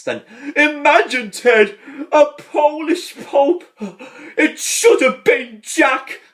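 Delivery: hammed-up weepy priest; mock grieving lament. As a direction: lament the loss loudly. Mourning priest in retelling.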